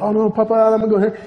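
put-on a kid begging his papa to let him go, hurried; scared plea. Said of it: "And I'm like".

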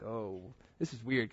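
soft, slow inner thought; slow, soft uneasy aside; quiet aside. Retold or imitated. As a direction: murmur it to yourself, unsettled. Inner reaction, surprised.

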